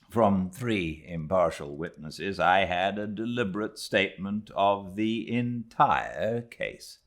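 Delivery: plain reading voice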